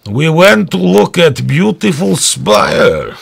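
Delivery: high, slow mock Russian accent. Mocking the suspects, in a slow Russian accent. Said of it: "that interview on T V with the two guys".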